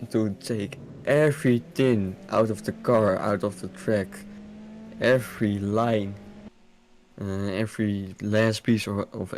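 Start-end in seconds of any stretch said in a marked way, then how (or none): none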